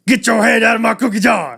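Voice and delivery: loud, high growling lion shout. Retold or imitated. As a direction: roar it like a toy lion. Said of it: "growls at you, goes".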